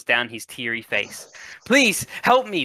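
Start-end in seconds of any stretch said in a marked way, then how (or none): 1.7-2.6 high-pitched desperate begging; pleading. Acted. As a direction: beg for help, voice pitched up. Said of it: "the man begs".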